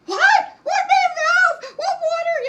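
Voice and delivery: shrieking very high and loud, laughing through it; clueless panic. Retold or imitated. Wife baffled by husband's main valve order, shrill laughing panic. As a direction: squeal it in total bewilderment. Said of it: "And I'm like".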